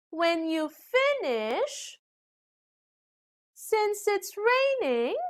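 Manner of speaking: slow and high, "finish" and "raining" swinging back up; rising, unfinished. Shown as a demonstration. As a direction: leave "finish" and "raining" hanging on a drawn-out rise. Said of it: "rising intonation when we couldn't finish what we are saying".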